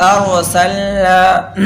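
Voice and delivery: अरसलना with a heavy, rounded R; full, heavy R. Shown as a demonstration. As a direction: read अरसलना with the R full, almost "aro-salna". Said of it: "इसको कैसे पढ़ेंगे". (अरसलना, रा को पुर करके)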